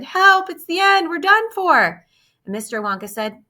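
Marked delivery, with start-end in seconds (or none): 0.0-2.0 high, loud cry of doom; panicked shout. Acted. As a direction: yell as if the end has come. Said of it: "And Grandpa Joe shouted".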